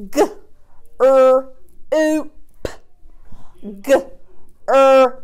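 B-er-ad stretched into separate sounds, slowly. Shown as a demonstration. Teacher sounding out a word for young pupils, stretched. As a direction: sound out B-er-ad segment by segment. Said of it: "sounding out".

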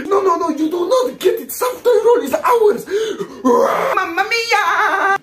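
put-on high, heated Italian-accented rant building to a yell; squabbling Italian. An Italian shouting at an Austrian over South Tyrol, heated high yelling. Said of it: "an average Austrian versus Italian confrontation".

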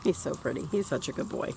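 doting murmur. Real, not acted. Doting on freshly bathed dog.

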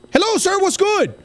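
very high-pitched startled hello; startled greeting. Real, not acted. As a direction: yelp a panicked greeting. Sudden encounter with game figure.

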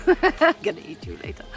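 high, loud teasing voice to a little companion; mock menace pitched high, laughter on either side; playful threat. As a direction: tease it in a high, giggly voice. Playfully threatens her foraged find.

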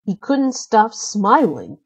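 ordinary delivery, nothing marked

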